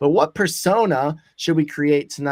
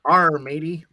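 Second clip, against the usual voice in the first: pirate voice; 5 dB louder than usual. Retold or imitated. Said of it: "you will answer".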